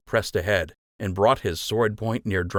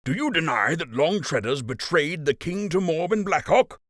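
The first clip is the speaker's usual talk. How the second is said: higher, hard accusing question; accusing captain; 9 semitones higher than usual. Acted. Captain accusing Wilfred of betrayal, hard accusing tone. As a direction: press the charge in his face.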